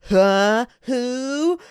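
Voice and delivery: high, exaggerated puzzled squeal; baffled girl. The girl asking who this is, high-pitched and baffled. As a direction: imitate a girl who has no clue. Imitates the girl's baffled reaction.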